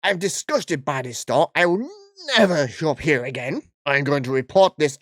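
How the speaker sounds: hammed-up outraged customer; Karen voice. Karen threatening to report the store, mock outraged. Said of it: "I can't keep this voice up".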